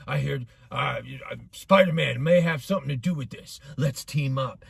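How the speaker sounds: low, softened villain voice; quiet, low-voiced comic-book villain; villain proposing an alliance. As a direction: pitch it down low, like a villain making an offer. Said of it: "This villain goes up to the anti-hero and he's like".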